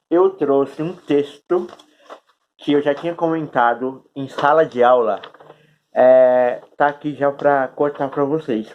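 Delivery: talking around a cork held in the mouth. Real, not acted. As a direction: speak with a cork between your teeth. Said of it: "É engraçado falar com essa rolha na boca".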